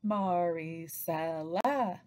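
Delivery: slow, voiced, separated syllables; syllable-by-syllable saying. Shown as a demonstration. Teacher models saying a name, syllable by syllable. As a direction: say the name aloud slowly in beats. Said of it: "Now say it".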